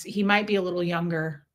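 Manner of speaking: ordinary delivery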